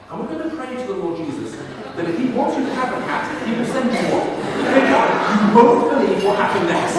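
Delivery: can't hold back the laugh, building to a loud burst; laughing through the punchline. Retold or imitated. Mother telling the vicar her story, breaking into laughter.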